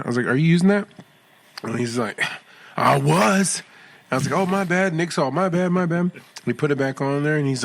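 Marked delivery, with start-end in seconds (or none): 0.3-0.9 raised, louder question; higher-pitched retold asking; retold polite query. Retelling gym run-in, asking stranger. 2.8-3.6 higher, slightly louder retort; put-out imitation of a stranger; retold indignant reply. Voices annoyed stranger at gym. 4.4-6.1 high-pitched apologetic retelling; retold apology. Retold hasty apology at gym.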